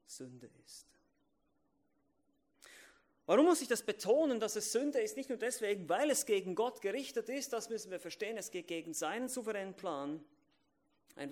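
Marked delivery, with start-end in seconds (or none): 3.2-10.2 loud, high, insistent pulpit voice; preaching at full force. Acted. Preacher hammering home why sin matters, in full force. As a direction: drive the point home from the pulpit.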